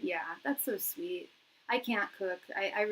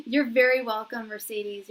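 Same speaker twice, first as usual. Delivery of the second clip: ordinary delivery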